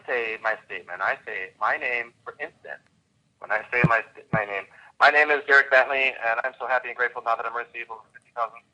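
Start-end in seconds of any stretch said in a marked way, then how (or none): 5.0-8.6 rattled off fast, like a chore; rushed wrong way. Shown as a demonstration. Coach showing students the wrong way, rushed fast. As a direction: hurry through it without feeling. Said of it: "That is not the way to do it".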